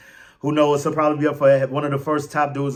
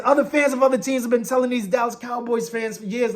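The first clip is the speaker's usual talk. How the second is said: normal talking voice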